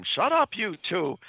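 high-pitched calling through a wall; mock yell from next room. Podcaster as Kachu in the next room, mock annoyed yell. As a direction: yell at noisy roommates, hammed-up. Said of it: "you hear from the next room".

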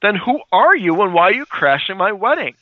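high, indignant mock bride; hammed-up outraged demand, pitched well up; retold flipping out. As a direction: act out a bride losing it at intruders. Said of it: "she starts flipping out".

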